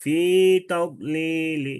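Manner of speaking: slow chanted "তাদলিলি" ending in a light "li" with no n; rawm, tanween dropped. Shown as a demonstration. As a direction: draw out "তাদলিলি", then a short faint i. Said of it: "তানবিন আছে কিন্তু লিন হবে না".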